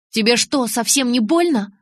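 furious question. A narrator's reading. Bella asking Jacob if her punch hurt, furious. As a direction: demand it, angry and incredulous. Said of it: "злая как чёрт".

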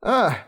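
short sharp cry, high pitched; a cut-off cry of terror; death cry. Acted. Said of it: "только и успел издать дворянин".

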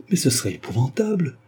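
a touch of shocked indignation; indignant cry. A narrator's reading. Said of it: "s'écria Flavia avec indignation".

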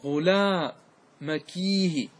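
وغلامكيه with a stretched ee before the final h; slow teaching model of "ghulaamakiihi" in وغلامكيه; lament with ya. Shown as a demonstration. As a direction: draw out وغلامكيه very slowly, long "kii", then "hi". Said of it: "فاجعل الياء بعد".

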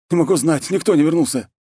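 high-pitched distressed confession; panicked report. Acted. War chief confesses the lost raiders, high panicked voice. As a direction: blurt out bad news, high.

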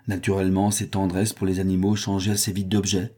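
usual speaking voice

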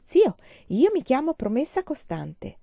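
proudly. A narrator's reading. Boy tells his new uncle his name, slow and proud. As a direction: puff up like a proud child. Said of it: "disse fieramente".

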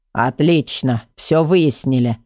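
low, slow grumpy voice; grumbler summing up. A narrator's reading. Grumbler closes the squabble, grumpy. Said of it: "подвёл итог Ворчун".

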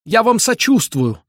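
high-pitched heartfelt exclamation; emphatic exclamation. A narrator's reading. Bender shakes old man's hand, high fervent exclamation. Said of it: "воскликнул он".

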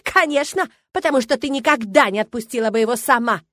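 loud, high, malicious retort; spiteful. A narrator's reading. Milena admits framing her rival, viciously triumphant. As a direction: crow it loudly in her face. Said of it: "Она злобно рассмеялась".

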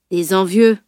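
usual speaking voice

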